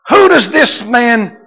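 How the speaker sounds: high, loud, slow, worked-up quote; excited. Acted. Preacher voicing scandalised scribes, excited and loud. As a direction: burst out as the outraged scribes. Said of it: "so excited I can't even speak".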